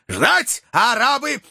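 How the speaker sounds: high-pitched incredulous questions; alarmed protest. Acted. Dick objects to waiting while Arabs chase Joe, alarmed protest. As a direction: throw it back in disbelief, pitch leaping up.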